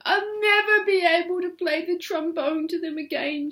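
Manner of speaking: high whispered grieving mother; whispered lament. Acted. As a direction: whisper it through grief, high. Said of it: "she said".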